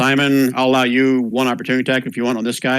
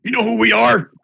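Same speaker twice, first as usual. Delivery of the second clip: high, raised character voice; indignant enemy retort; 13 semitones higher, 4 dB louder than usual. Acted. Game master answers the dwarf's threat as the enemies, high indignant retort. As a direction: throw it back loudly as a challenge.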